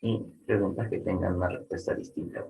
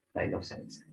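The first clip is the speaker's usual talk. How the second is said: Bailó salsa said softly, falling on salsa; soft statement, salsa dropping at the end; Bailó salsa with a quiet descending tune; 8 dB quieter than usual. Shown as a demonstration.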